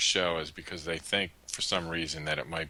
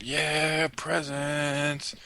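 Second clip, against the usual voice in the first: put-on excited kids; 9 semitones higher, much slower than usual. Kids excited on Christmas Eve.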